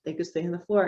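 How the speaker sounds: normal talking voice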